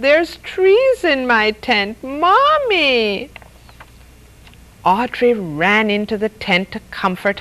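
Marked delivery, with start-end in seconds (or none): 0.0-3.3 high, drawn-out wail to Mommy; a little boy's alarmed cry from bed; slow, high-pitched call for help; child crying out. A narrator's reading. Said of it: "he cried".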